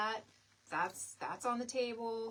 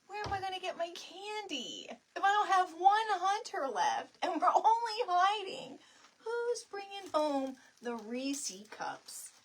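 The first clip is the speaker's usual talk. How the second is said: mock whine; 8 semitones higher, 7 dB louder than usual. Real, not acted. Mock whine about candy.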